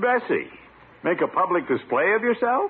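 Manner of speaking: high, scandalised disbelief; shocked. Acted. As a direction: pitch it up, appalled at the thought. Boss shocked at secretary's idea.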